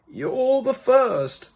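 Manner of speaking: put-on high, slow old man's voice; a slow, high, courteous old man; second old man. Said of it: "said the second".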